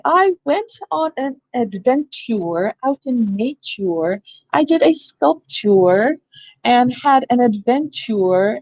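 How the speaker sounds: slow, drawn-out "adventure", "nature", "sculpture" ending in "tyoor"; silly spelling pronunciation. Shown as a demonstration. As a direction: overdo a hard T in "adventure", "nature" and "sculpture", slowly. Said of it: "you can be silly and say".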